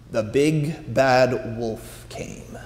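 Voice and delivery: slow, low and dark; ominous. A narrator's reading. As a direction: announce the wolf with menace. Wolf arrives, ominous narration.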